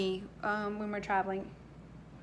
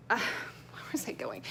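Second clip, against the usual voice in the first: whispered aside; 2 semitones lower, 7 dB quieter, slower than usual. Real, not acted. Aside to herself, lost thread.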